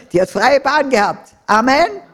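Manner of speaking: laughing, loud and high; gleeful laugh into a loud amen. Real, not acted. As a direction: laugh it out with joy. Laughing punchline then amen.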